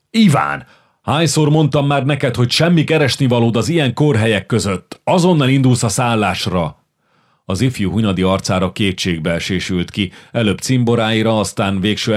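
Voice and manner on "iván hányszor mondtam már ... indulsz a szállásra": higher, louder reprimand; elder brother scolds Iván in a tavern, stern and loud; stern scolding. Acted. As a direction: order the little brother out sharply. Older brother scolds younger.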